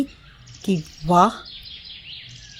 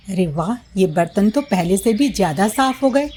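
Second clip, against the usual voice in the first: ordinary delivery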